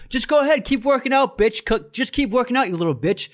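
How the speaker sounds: imitating the rude guy's high sneering taunt; high-pitched retold jeer; mock taunting insult. Gym guy mocking the narrator a second time: high sneering taunt.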